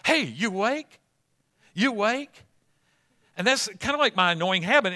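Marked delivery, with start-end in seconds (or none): 0.1-2.5 nudging wife awake. Retold or imitated. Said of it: "and I'll go like".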